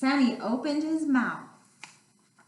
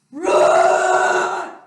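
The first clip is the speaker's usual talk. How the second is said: big shouted dinosaur roar, pitched high; loud playful dinosaur roar; 8 semitones higher, 11 dB louder than usual. Acted. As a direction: roar it out full force. Dinosaur roars at bus stop.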